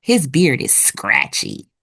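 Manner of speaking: ordinary delivery, nothing marked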